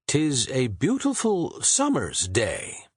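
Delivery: slow, high poetic declaiming; Shakespeare declaiming. A narrator's reading. Shakespeare musing aloud to the butcher. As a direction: roll the line out like verse. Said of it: "said Shakespeare".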